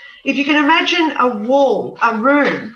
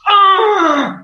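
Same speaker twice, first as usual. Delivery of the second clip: high, loud frustrated groan; exasperated groan; 9 semitones higher, 7 dB louder than usual. Retold or imitated. Angry great grandmother groaning in frustration. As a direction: let out a fed-up ugh. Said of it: "this is how she said it".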